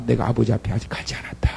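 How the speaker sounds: fast, hushed whisper. Acted. Jesus whispers to Mary not to touch him yet. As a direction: breathe the words secretly to her.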